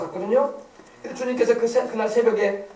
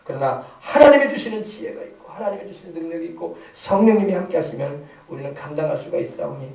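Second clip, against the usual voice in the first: lower-pitched, earnest pastoral prayer; 5 semitones lower than usual. Real, not acted. Pastor praying to God for the congregation, prayerful. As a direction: pray aloud, swelling on key petitions.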